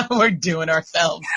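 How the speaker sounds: teasing through laughter; laughing. Real, not acted. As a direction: giggle the words out.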